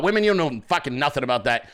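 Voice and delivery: normal talking voice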